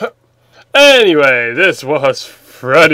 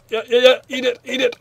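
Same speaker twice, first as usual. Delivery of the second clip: ordinary talk, nothing marked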